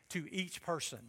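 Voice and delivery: "each" leaned on and lengthened, falling at the end; emphasis on each person. Shown as a demonstration. Pastor driving the point home to the church, stress on "each". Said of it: "Let me emphasize this again".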